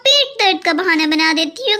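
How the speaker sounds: usual speaking voice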